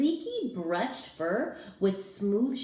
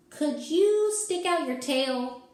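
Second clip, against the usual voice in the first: ordinary delivery, nothing marked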